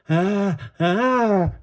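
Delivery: high wailing cries; frightened wail. A narrator's reading. Little brother wails in fear, high wail. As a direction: wail like a scared little kid. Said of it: "Teddy wailed".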